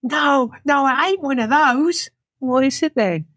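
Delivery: high-pitched, querulous voice; disagreeing initiate. Acted. Other initiate correcting her, acted high-pitched disagreement. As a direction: dispute your companion's guess.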